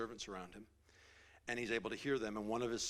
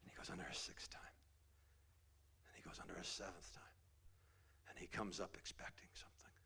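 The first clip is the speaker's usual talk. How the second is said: whisper; 3 semitones lower, 11 dB quieter than usual. Real, not acted. Hushed build to healing.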